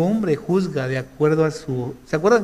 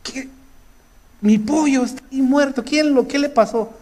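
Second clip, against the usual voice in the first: higher, shocked mother's voice; mother's alarm; 9 semitones higher than usual. Retold or imitated. Mother discovers the dead chick, shocked, in a high voice. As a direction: play the mother finding her dead chick. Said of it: "Mi mamá, digo".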